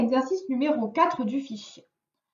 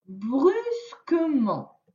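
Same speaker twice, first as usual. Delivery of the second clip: chaque mot détaché, lentement; lecture lente de maîtresse; 3 semitones higher, much slower than usual. Shown as a demonstration. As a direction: lis le mot pour l'enfant, syllabe par syllabe. Maîtresse lit les mots lentement. (lecture scolaire, lente et articulée)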